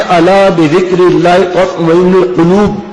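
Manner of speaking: measured, precise Arabic recitation ending on القلوب; careful Quranic articulation. Shown as a demonstration. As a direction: recite slowly, giving القلوب its back-of-throat qaf. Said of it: "قاف یہاں سے ادا کیا".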